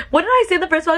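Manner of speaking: high, cracking tearful question; laugh-crying at herself; asking viewers while crying. Real, not acted.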